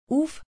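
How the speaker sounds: a shuddering huff, pitched high; shivering uff. A narrator's reading. Lory shivering at the dry history lesson: shuddering uff. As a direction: shiver out a sharp, startled Uff. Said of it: "con un brivido".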